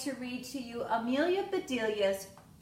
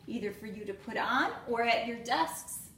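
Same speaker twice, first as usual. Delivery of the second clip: normal talking voice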